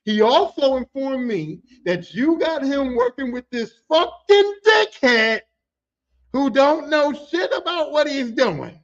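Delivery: slow, drawn-out furious rant, shouted at the end; quoting an angry boss. Retold or imitated. Boss blasting the crew about the dickhead, slow angry shout. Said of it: "and I quote".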